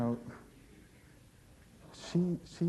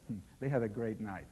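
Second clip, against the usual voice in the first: ordinary delivery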